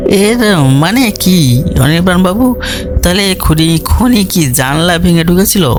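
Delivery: high-pitched, urgent questioning; anxious questioner. Acted. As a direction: press the detective, pitch lifted. Colonel anxiously questions detective. (উদ্বিগ্ন কর্নেলের চড়া গলায় প্রশ্ন)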